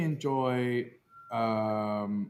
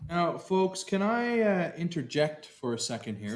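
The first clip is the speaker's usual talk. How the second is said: high, loud mock announcer; 7 semitones higher, 4 dB louder than usual. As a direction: pitch it like a host. Announcer plug.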